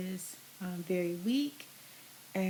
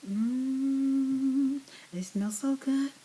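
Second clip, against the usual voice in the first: delighted savoring; 4 semitones higher, 4 dB louder, much slower than usual. Real, not acted. Savoring peanut butter smell.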